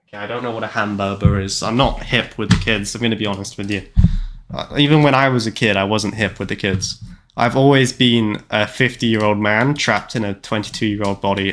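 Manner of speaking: ordinary talk, nothing marked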